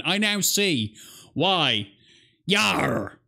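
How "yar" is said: mock pirate. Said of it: "I be loving this content".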